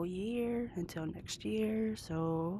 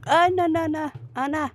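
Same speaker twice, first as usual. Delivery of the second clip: loud high panicked pleading; panicked cry; 9 semitones higher, 9 dB louder than usual. Real, not acted. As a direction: shout in alarm as you fall. About to get knocked off.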